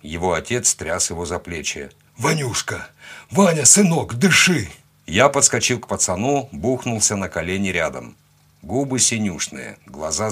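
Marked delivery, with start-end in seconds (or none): 2.2-4.7 higher, louder panicked pleading; desperate father. A narrator's reading. Cossack father shaking his drowned son, desperate cry. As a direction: cry out, begging your child to breathe.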